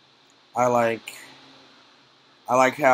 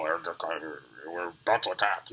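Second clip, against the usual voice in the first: much lower, slow, muffled trooper radio talk; stormtrooper voice; 8 semitones lower, 7 dB quieter, much slower than usual. Retold or imitated. Reviewer imitating stormtroopers in the opening scene, slow and low. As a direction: drop low and slow like a helmeted soldier. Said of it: "You know how they talk".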